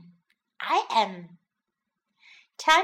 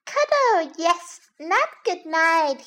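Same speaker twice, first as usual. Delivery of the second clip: put-on high, bright-eyed child voice; little bunny; 6 semitones higher, 4 dB louder than usual. A narrator's reading. Little bunny wanting a hug not sleep, high childish pleading. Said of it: "Little Bunny reached up for a hug".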